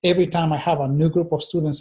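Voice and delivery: usual speaking voice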